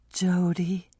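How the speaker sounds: breathless whisper of Jody; Jody, barely breathed. Acted. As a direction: whisper the name. Lynn voiced.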